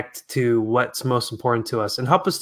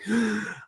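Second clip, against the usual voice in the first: startled gasp; 8 semitones higher, 9 dB quieter than usual. Retold or imitated. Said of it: "I'm about to get emotional".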